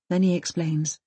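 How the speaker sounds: usual speaking voice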